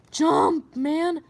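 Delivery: loud command; shouting. Real, not acted. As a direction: yell it at the screen. Yelling at game character.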